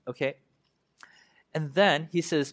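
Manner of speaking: usual speaking voice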